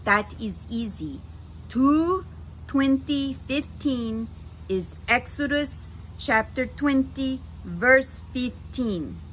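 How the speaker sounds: put-on slow, careful German accent, spelling out numbers; thick German accent. Gutenberg explains the Bible reference, slow thick German accent. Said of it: "a thick German accent".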